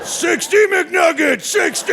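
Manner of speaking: high, slow, yelled order; mock-heroic yell; triumphant shout. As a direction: bellow the order like a victory cry. Triumphant order at drive-thru.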